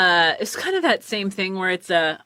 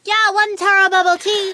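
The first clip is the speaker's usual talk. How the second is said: higher, louder cashier voice with an unusual timbre; lightly voiced barista; mock barista voice; 12 semitones higher, 5 dB louder than usual. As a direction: repeat the order in a striking put-on voice. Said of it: "And like".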